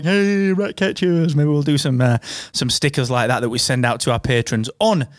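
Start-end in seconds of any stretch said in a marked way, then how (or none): 0.0-1.4 slow, higher put-on catchphrase; imitating Don. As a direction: sell it like the slogan printed on the merch. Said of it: "like, swag where it's like".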